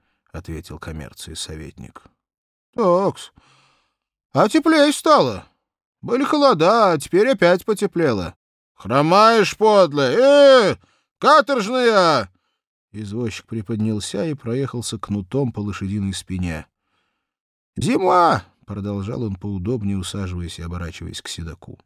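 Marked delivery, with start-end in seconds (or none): 2.8-12.3 put-on higher, chatty young cabman, louder and lively; rough young coachman yelling at his horse; cabman Vanka voice. 17.8-18.4 put-on higher, chatty young cabman, louder and lively; rough young coachman yelling at his horse; cabman Vanka voice. Vanka continues.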